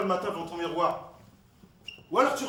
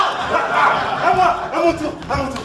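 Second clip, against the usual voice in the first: high, loud, repeated cry for a turn; eager outburst; 6 semitones higher, 12 dB louder, slower than usual. Acted. Friend demanding his turn in the guessing game, excited shout. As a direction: clamour excitedly to go next.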